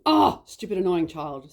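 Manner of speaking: re-enacted burst of exasperation; exasperated grumble. Mum told to apologise to her child, exasperated.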